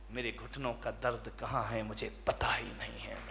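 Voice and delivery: whisper. Real, not acted. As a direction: breathe it like a secret. Quiet wonder at healed knees.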